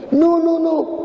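high, quick panicked no's; panicked denial. Acted. Peter caught out, denying in a high panic. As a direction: deny it in a jumpy fright.

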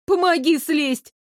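high, slow whimpered plea; tearful plea. A narrator's reading. Stuck girl asks Elisa to get her down, tearful. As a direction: beg tearfully to be helped. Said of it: "всхлипнула я".

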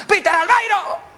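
high, slow, drawn-out alarm yell; acted crew's shouted warning; panicked shouting. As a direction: holler it across the whole ship. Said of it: "empiezan a gritar".

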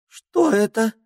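tiny ringing frightened voice; high tiny voice, scared; snowflake sprite. Acted. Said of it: "испуганно шепнул тоненький звенящий голосок".

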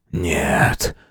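low, soft, dying croak; feeble drawl. A narrator's reading. Wounded man protests from the ground, feeble. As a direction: stretch it out weakly. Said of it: "Снова протянул он и закашлялся".

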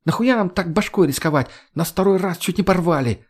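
high, agitated female character voice; exasperated young woman jabbering; agitated chattering. A narrator's reading. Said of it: "затараторила девушка".

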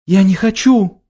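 put-on high, loud terrified cry of a child; panicked scream. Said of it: "Глеб запаниковал".

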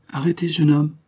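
a sharp, commanding stop, pitched high; with authority. A narrator's reading. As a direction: halt the young man with an old soldier's authority. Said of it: "dit-il avec autorité".

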